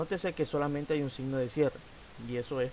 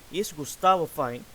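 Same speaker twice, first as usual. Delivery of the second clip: loud model question; 2 semitones higher, 7 dB louder, slower than usual. Shown as a demonstration.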